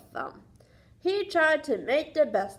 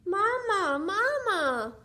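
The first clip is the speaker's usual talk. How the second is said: gosling calling; 4 semitones higher, slower than usual. Acted. Goslings follow bear calling.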